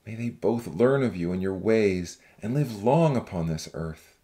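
deep, measured male voice praying; low, reverent man's voice; earnest prayer. Acted. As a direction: plead sincerely with God, pitched very low. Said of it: "Zach prayed earnestly".

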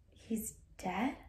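whispered in disbelief; whispered shock at the news. Acted. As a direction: breathe it out, stunned.

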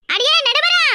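high call, rising at the end. Acted. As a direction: holler out across the crowd. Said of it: "கத்தி கத்தி கூப்பிட்டுக்கிட்டு கிடந்த". (குரலை உயர்த்திக் கூவி அழைத்தல்)

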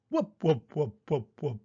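short, evenly spaced falling whops; rhythmic wup noises; imitated whop-whop. A narrator's reading. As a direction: pulse it like a turning machine.

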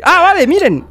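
ordinary delivery

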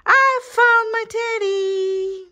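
high, loud cry of delight; joyful exclamation. A narrator's reading. Little rabbit finds the teddy in bed, overjoyed. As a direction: sing out the happy discovery.

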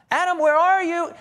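high, loud call of Adam's name. Pretend. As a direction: call out across the garden, searching. God calling out for Adam.

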